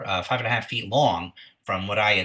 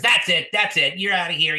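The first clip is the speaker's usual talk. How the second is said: loud, higher-pitched acted outburst; fed-up bartender; 7 semitones higher, 6 dB louder than usual. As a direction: throw them out, patience gone. Acted bartender throws them out.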